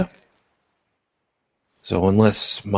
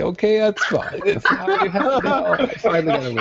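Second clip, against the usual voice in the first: higher, laughing through the words; 12 semitones higher than usual. Real, not acted. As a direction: giggle out the punchline. Teasing joke lands, laughing.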